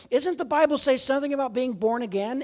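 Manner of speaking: high, exasperated imitated challenge; retold anger. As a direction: act out an indignant question. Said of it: "atheist doctor laying on the bed is angry".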